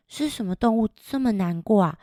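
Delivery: ordinary delivery, nothing marked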